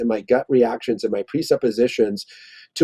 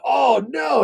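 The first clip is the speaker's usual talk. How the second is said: mock shocked oh no; 12 semitones higher than usual. Acting out a shocked reaction.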